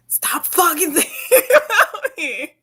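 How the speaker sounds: loud, high, laughing outburst; slow, emphatic mock protest breaking into laughter; exasperated. Acted exasperated retort to ex.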